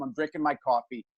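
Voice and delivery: ordinary delivery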